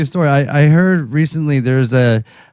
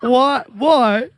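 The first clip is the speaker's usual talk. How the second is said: much higher, hammed-up British accent; 10 semitones higher, much slower than usual. Pressing Louis about joining the band in a British accent. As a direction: ham up the British accent, pitched high. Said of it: "With that British accent".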